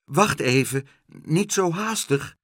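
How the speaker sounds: mild, slowed call after someone; feeble call. A narrator's reading. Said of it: "riep nog zwakjes".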